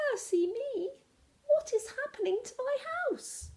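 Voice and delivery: high, alarmed cry of the baker. Acted. Missus Jones sees her house bulging, cries out high in alarm. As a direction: shriek it up high in dismay. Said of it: "she cried".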